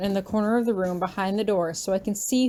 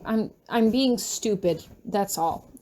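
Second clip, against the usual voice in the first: plain reading voice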